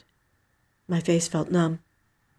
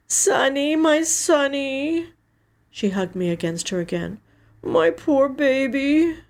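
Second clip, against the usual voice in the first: slow, tender and higher; slow, high tender comfort; 8 semitones higher than usual. Acted. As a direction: cradle him with the words, slowly. Sister comforting grieving brother.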